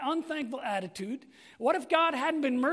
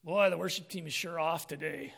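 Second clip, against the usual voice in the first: lower, sour mock complaining; grumbling churchgoer; 8 semitones lower, 5 dB quieter than usual. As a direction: mimic a grumpy worshipper picking faults. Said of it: "you're gonna be like".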